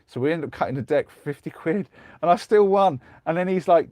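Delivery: ordinary talk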